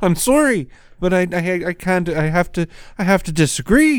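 high, prim, apologetic voice; mock-polite Jordan Peterson; overly polite voice. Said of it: "he gets more and more polite".

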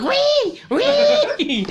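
high squealing pig noise, loud and laughing. Retold or imitated. Friend squeals like the pig they dragged, high and loud. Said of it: "the pig is like".

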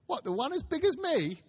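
put-on high, wide-eyed boy's voice; a small boy gasping at the size of it; amazed boy. Boy asks Scrooge about the turkey, amazed and high.

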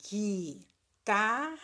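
slow "guitarra", ghi-ta-rra without a U sound; silent U. Shown as a demonstration. Teacher asking pupils to notice the missing U, slow syllables. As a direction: draw out each syllable of "guitarra". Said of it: "Eu falei o U?".

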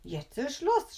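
high, cross outburst; furious shout. A narrator's reading. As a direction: shout it angrily as a fed-up grandma. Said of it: "schreit die Oma wütend".

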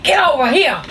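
mock-angry order, R dropped in "here"; angry R pronunciation. Shown as a demonstration. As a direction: demo of an angry Southern "Get over here!". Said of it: "Just the way I say it when I'm angry".

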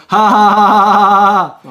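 loud, slow, spelled-out ha ha; hammed-up deliberate laughter; mock laugh read out. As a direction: read out a commenter's laugh, slowly and loudly. Reads commenter's laugh aloud.

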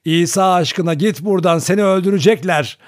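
high, loud, urgent plea; shouted warning. A narrator's reading. Grandmother at the window warning her son, shouting. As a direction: shout to drive him away. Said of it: "bağırarak sallamaya başladı".

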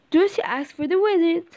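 ordinary delivery

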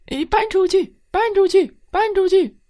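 very high tiny chanting voices; 小老鼠起哄; squeaky mice chorus. A narrator's reading.

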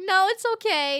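high, loud, nervous politeness; telling a creepy driver no thanks, nervously; nervous polite no. Retold or imitated. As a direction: brush him off brightly, high and loud. Politely refusing a stranger's car.

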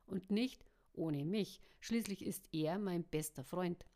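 ordinary delivery

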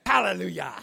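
loud exultant hallelujah; shouted hallelujah. Real, not acted. Preacher to congregation, loud hallelujah. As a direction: shout it to the church.

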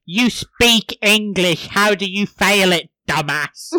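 loud, emphatic mock telling-off; mock scolding mom. Son imitating his mom over failing English, mock scolding. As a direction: imitate an exasperated mom, punching the words. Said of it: "You, his mom".

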